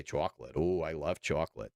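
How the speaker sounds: "chocolate" in a low Boston accent; low-pitched, playful Boston accent. Shown as a demonstration. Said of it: "gets me going in a Boston accent".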